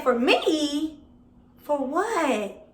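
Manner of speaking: can't believe they want her; startled retold outburst; shocked disbelief. Caught off guard by police.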